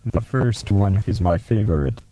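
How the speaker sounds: ordinary talk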